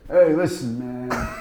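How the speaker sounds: laid-back "hey, listen, man" drawl; lightly voiced cool actor; Matt Dillon impression. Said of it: "He do".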